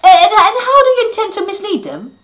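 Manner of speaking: high, loud eager question; bursting with curiosity. Acted. Eager boy asking.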